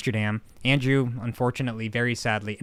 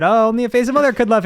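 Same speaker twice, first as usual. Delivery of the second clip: lifted, louder quip; mock loud retort; 8 semitones higher, 6 dB louder than usual. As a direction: toss it out loud and high. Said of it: "I said".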